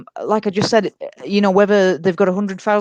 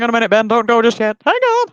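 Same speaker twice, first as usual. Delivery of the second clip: calling out; 3 semitones higher, 4 dB louder than usual. Real, not acted. Calling Ben back.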